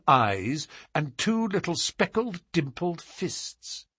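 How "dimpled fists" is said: ordinary delivery, nothing marked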